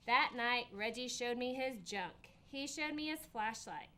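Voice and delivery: plain reading voice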